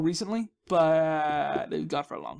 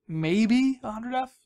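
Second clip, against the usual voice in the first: slow and higher, stretching "maybe a hundred F"; drawn-out repeat; 5 semitones higher, 3 dB louder, much slower than usual. Shown as a demonstration. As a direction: draw out "maybe a hundred F" slowly, pitched up.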